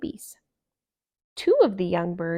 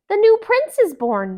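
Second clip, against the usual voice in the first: much higher and louder, thrilled; excited cry; 12 semitones higher, 11 dB louder than usual. Acted. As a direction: announce the birth with joy. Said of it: "the animals cried".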